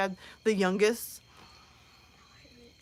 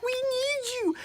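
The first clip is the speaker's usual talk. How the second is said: mock plea; 12 semitones higher, 4 dB quieter, slower than usual. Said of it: "I'm yelling after my mom".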